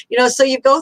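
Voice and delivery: ordinary talk, nothing marked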